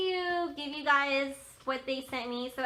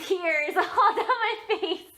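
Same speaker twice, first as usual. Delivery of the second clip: laughing through the words, a little higher and louder; 7 semitones higher, 6 dB louder, slower than usual. Real, not acted. To viewers, recalling her tearful walk to the truck, laughing. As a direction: chuckle while recalling the tears.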